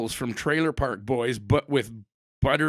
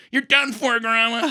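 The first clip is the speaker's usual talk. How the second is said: much higher and louder, crowing the win; triumphant gloat; 7 semitones higher, 5 dB louder than usual. Retold or imitated. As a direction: rub the victory in grandma's face. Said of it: "and I'm like".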